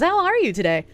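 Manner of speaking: chirpy and up high; sing-song hello to chat; bright high greeting. Real, not acted.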